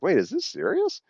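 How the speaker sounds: feigned innocent question. Retold or imitated. Feigning innocent surprise at manager.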